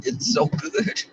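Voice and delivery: slow, high, soft longing. Real, not acted. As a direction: sigh it out with longing. Longing about celibacy.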